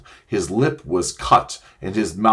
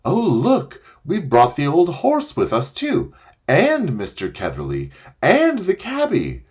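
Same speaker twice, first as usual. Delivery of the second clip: put-on high surprised girl's cry; 7 semitones higher than usual. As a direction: call out in surprise. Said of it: "Polly cried out".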